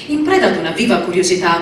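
ordinary delivery

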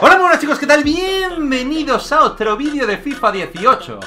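high, loud YouTuber intro; high-energy channel opener; hyped greeting. Real, not acted. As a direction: burst in with a big excited welcome. Hyped opening greeting to viewers.

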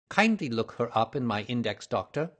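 usual speaking voice

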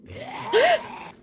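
frightened shrill gasp; startled high gasp. Real, not acted. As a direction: gasp at the jump scare. Jump scare by a zombie.

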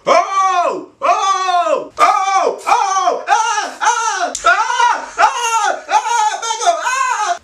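high-pitched freaked-out shouts with laughter; panicked yelling. Retold or imitated. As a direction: yelp in disgusted panic, cracking up. Panicked reaction to feeling it.

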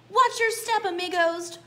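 a loud, high alarm call; loud warning. Acted. Paquito Tito warning his amigos near the elephant, loud and high. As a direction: shout the warning to your friends. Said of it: "warned Paquito Tito".

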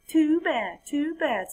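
high, dismissive sing-song; smug frog. Acted. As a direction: brush it off with smug pity.

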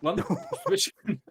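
laughing. Real, not acted. Laughing surprise in game.

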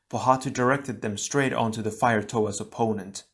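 ordinary delivery, nothing marked

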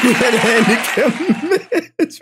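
high, breaking through laughter; trying again but cracking up; laughing. Real, not acted. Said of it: "tears is coming out of my eyes".

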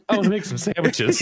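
put-on high old lady voice; sing-song old granny; old lady impression. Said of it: "the old lady from Bad Grandpa".

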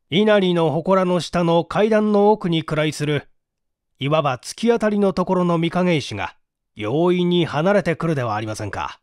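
plain reading voice

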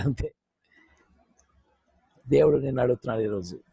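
ordinary talk, nothing marked

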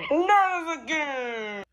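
dismayed cry. Pretend. Character dreads repeat disaster.

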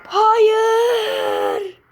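loud, high, crying shout; anguished no. Real, not acted. Kid failing the level, crying loud no. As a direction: wail at the failure. Falling, must restart.

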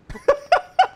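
high burst of laughter; exasperated laugh. Real, not acted. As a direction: laugh out in frustration.

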